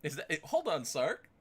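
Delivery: usual speaking voice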